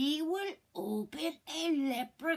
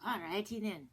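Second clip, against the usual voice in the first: ordinary delivery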